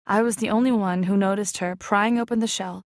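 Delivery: plain reading voice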